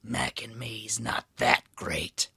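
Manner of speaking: slow, raspy half-whisper; shadowy stranger, slow and hushed; mysterious man in darkness. A narrator's reading. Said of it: "said a man standing in the darkness".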